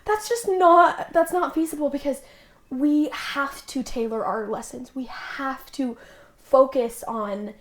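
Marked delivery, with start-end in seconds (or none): 0.0-1.9 high, loud and slow, firm; emphatic objection. Retold or imitated. Missionary tells her president it isn't feasible, emphatic. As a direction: lean hard on each word to refuse.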